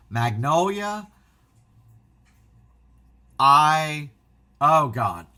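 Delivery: can barely get the words out; a fan overcome by the news; overwhelmed fan. Real, not acted. Overwhelmed by favorite film news.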